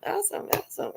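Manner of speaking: very high, airy delighted squeal; squeaky glee. Retold or imitated. Wife thrilled the car works, high airy squeal. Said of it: "I'm like".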